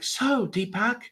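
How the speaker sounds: imitating a delighted TV host; Oprah. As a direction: stretch out a gushing greeting to the guest. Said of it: "then she's like".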